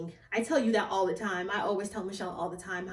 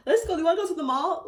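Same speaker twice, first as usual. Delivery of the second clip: eager invitation; 8 semitones higher, 6 dB louder, faster than usual. Retold or imitated. Acts out eager teens planning mall trip.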